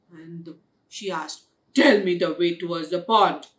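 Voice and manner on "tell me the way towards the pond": loud, fast, low order; rude demand. A narrator's reading. Blackie orders the bear to give directions, rude demand. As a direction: bark it out with no courtesy. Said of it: "She just very rudely".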